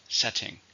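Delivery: "Setting" with SET stressed; stress on first syllable. Shown as a demonstration. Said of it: "Stress is on the first".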